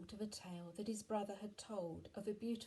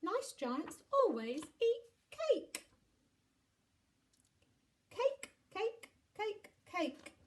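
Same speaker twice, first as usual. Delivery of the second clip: put-on high, slow, loud child chant; high-pitched childish chant; insistent children; 14 semitones higher, 9 dB louder, slower than usual. A narrator's reading. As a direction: spell it out slowly for the giant. Children urge cake on giant.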